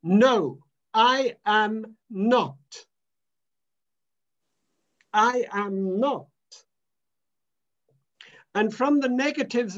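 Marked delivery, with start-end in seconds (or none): 0.0-6.3 firm, slow, loud No with weight on NOT; emphatic denial. A narrator's reading. John the Baptist refusing to be called the Messiah, emphatic. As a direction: plant each word like a flat refusal. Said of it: "said an emphatic".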